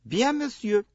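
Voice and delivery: high, polite French attendant. Acted. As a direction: answer briskly like a waiter. Attendant answering.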